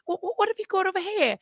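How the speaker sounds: high, hesitant mock British; put-on British accent. Companion plays along with the bit, high fake British accent. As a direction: imitate someone faking a British accent. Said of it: "doing the accent".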